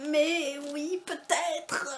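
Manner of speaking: high, drawn-out doubtful "mais oui"; sceptical mock reply. Telling viewers how she doubted the hyped book, mock sceptical. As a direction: act out your own disbelief at the hype. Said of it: "et moi j'étais là".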